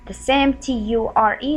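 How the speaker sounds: ordinary delivery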